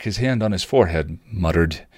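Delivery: ordinary delivery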